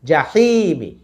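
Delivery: জাহিমি with quick জা and long হি; stretched middle. Shown as a demonstration. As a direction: sustain হি, short final মি. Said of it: "হিতে টান".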